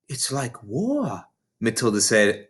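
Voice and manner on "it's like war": soft, slow and awestruck; overawed. A narrator's reading. Matilda awed by the older girl's story, soft and slow. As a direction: breathe it in awe.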